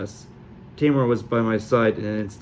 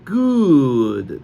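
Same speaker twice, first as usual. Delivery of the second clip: hammed-up Sully accent, high then loud on good; outrageous Sully accent; 7 semitones higher, 4 dB louder, much slower than usual. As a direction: overdo a fake Sully accent. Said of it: "the most outrageously Sully accent".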